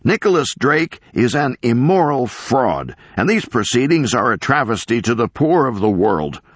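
high, impassioned public tirade; crazed professor playing to the cameras, building to a high cry; ranting denunciation. Professor denouncing Drake to the cameras, ranting.

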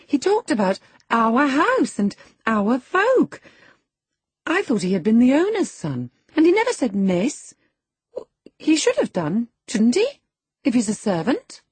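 put-on high, loud girlish complaint; tiresome haughty girl. A narrator's reading. Girl complaining to her nurse about the lad, haughty high voice. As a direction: complain like an offended little miss.

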